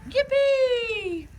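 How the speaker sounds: very high whoop of glee; yippee cry. A narrator's reading. The T-rexes cheering at the fallen tree, high whoop. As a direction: squeal it out with joy. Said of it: "the T's cried out".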